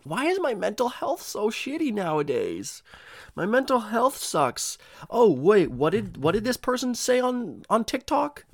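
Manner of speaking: high, slow, whining mock lament; whiny TikTok addict, hammed-up; mock whiny complainer. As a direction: play a clueless self-pitying scroller. Mocking someone blaming TikTok.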